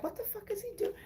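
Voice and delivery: high, soft incredulous squeak; mock baffled inner voice. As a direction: ask it like you can't believe your eyes. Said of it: "So I'm like".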